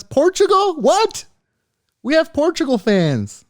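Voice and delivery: shocked delight at Portugal fans; surprised. Real, not acted. Shocked delight at listener stats.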